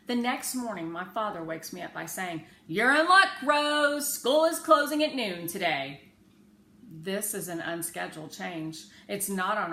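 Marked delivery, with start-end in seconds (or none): 2.7-6.0 father's loud call. A narrator's reading. Father wakes daughter with news.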